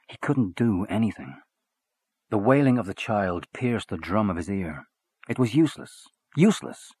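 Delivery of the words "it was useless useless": rising frustration. A narrator's reading. Frustration builds.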